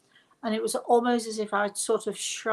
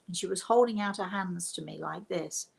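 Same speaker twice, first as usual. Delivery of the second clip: ordinary talk, nothing marked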